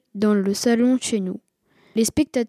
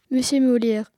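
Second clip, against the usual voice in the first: usual speaking voice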